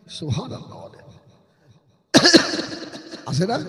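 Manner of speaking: soft, close preaching voice; hushed sermon. Acted. Preacher telling a sad story to the crowd, hushed and tender. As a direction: draw the listeners in, hushed and tender.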